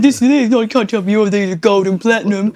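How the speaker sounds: loud mock-indignant queue-jumper; mock Aussie. Retelling the queue-jumper's protest, loud mock-indignant. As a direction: bluster like a pushy tourist. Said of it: "He said".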